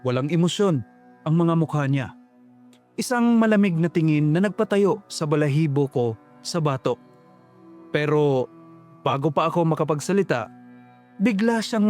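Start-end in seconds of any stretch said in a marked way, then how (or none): none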